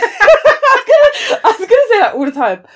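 breaking into laughter; laughing. Real, not acted. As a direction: laugh through the words. Laughing at own joke.

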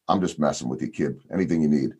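a hushed, lightly voiced ballplayer letting him off the hook; low, soft reassurance. Mark Grace telling a nervous young reporter, low and quiet. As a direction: drop low and quiet, easing the joke off. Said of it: "he finally looks at me and he says".

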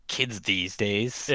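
put-on slow, drawn-out light old man voice. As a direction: drag it out like a lightly voiced grandpa. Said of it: "I say my old man voice".

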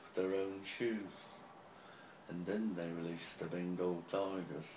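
put-on British-accented roadie telling a tall tour tale; put-on UK accent, old roadie spinning a yarn. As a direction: quote an old UK roadie's rambling war story. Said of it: "That's how he talks".

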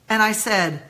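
ordinary talk, nothing marked

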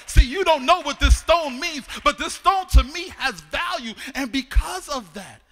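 loud, fervent preacher's cry; full-force preaching. Real, not acted. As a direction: whoop it out to the congregation.

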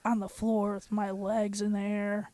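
man doing a high, soft woman's voice; girl's voice. Acted. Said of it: "Oh, I forgot the girl's voice".

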